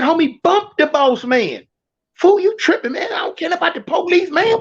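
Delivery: high, insistent urging; urgent pleading. Retold or imitated. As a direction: push him hard to agree. Urging him loudly.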